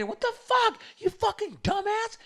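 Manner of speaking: very high falsetto outrage; mock her cursing in a squealing voice; imitated angry girl. Girl insulting the man who took her shot, mock falsetto fury. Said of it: "And she's like".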